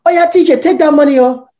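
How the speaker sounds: high, loud, delighted Nigerian mum; excited mum. Retold or imitated. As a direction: squeal it like mum suddenly happy. Mum suddenly agrees, imitated.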